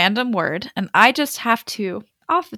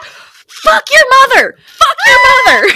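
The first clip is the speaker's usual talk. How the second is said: high, loud mock scream of rage; screaming curse; 12 dB louder than usual. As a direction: scream it like a furious street hustler. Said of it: "and screaming".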